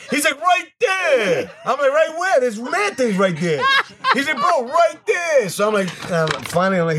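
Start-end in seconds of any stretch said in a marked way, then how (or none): 0.4-1.5 impatient shout. Retold or imitated. Foreman on ladder impatient. 1.9-2.4 confused raised voice. Retold or imitated. Confused helper asking back. 4.4-5.5 impatient shout. Retold or imitated. Foreman on ladder impatient.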